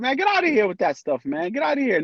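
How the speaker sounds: high, scoffing brush-off; dismissive friend. Retold or imitated. Friend brushing off an I love you, scoffing.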